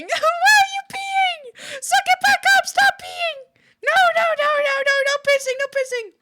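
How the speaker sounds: very high, loud squealed shout; panicked high-pitched scolding, words stretched; frantic yelling. Real, not acted. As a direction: yell it at the top of your voice. Sudden outcry at someone peeing.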